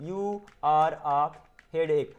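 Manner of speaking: very slow, with a gap before the last word. Shown as a demonstration. Teacher showing learners how to build the sentence, word by word. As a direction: dictate it one word at a time.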